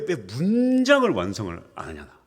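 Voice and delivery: normal talking voice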